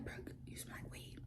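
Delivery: hushed whispered warning. Retold or imitated. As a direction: whisper it so the teacher won't hear. Friends warning her in class.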